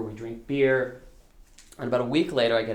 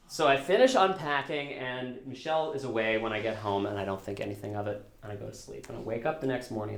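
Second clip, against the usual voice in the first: ordinary talk, nothing marked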